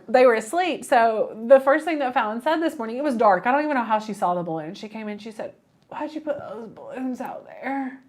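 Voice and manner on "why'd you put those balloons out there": put-on soft, slow quote of a little kid; a hint of a puzzled child, hushed; lightly imitated small child. Quotes small daughter just woken up.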